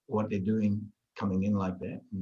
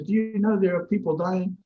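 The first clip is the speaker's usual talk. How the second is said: high, raised imitation of upset man; upset yelling; 8 semitones higher, 4 dB louder than usual. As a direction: mimic someone getting worked up. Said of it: "he's getting all upset".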